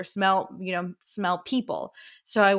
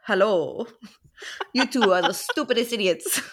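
hammed-up Spanish accent; mock Spanish-accented swashbuckling cat; Antonio Banderas. Joking with co-host about an imagined Baxian voice, mock Spanish accent. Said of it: "sound like Puss in the Boots".

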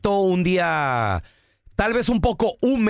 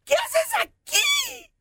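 shrill falsetto shriek, drawn out; mock high scream; much slower than usual. Comic retelling of Carola caught bathing by Andrés, high mock scream. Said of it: "Y la Carola grita".

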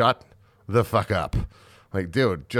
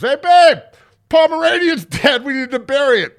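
very high, loud mock falsetto; squeaky falsetto; 9 dB louder than usual. Lineman mockingly squeaks to his girlfriend, very high falsetto.

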